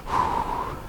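long unvoiced whoosh of breath; a quiet retold sigh of exasperation; dad's exhale. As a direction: blow the air out like a frustrated father. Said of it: "And he's like".

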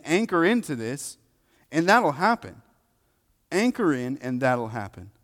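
impassioned preaching. Real, not acted. Preacher to congregation on coming to Scripture in faith, impassioned preaching. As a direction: preach it with force, pitch up and loud.

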